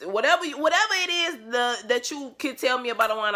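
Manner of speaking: high and loud wine bragging; excited boast. Real, not acted. Vlogger bragging about her wine knowledge, excited. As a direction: brag it up, excited.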